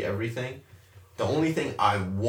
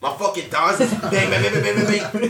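laughing; 6 semitones higher, 4 dB louder than usual. Retold or imitated. Describing thighs banging on ride.

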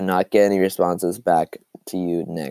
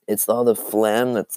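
normal talking voice

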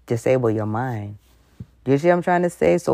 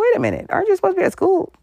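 much higher, quick suspicious question; puzzled uncle; faster than usual. Retold or imitated. Uncle realizing she should be at school, high puzzled. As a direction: catch the lie mid-greeting. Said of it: "He was like".

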